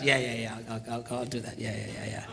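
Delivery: low, soft brush-off; dismissive husband. Retold or imitated. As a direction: placate a nagging wife under your voice. Husband brushing off wife's nagging.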